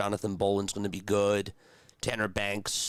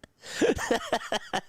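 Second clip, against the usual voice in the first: breathy high-pitched giggle; high unvoiced laugh; 14 semitones higher than usual. Real, not acted. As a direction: let a high airy laugh slip out. Laughing after imagining parents.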